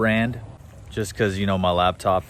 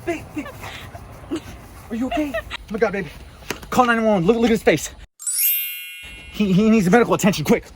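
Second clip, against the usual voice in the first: mock panic; 12 semitones higher, faster than usual. Mock panic over baby's face.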